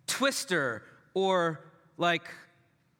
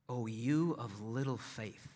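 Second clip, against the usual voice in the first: quiet rebuke; 10 semitones lower, 9 dB quieter than usual. Acted. Jesus gently rebukes disciples.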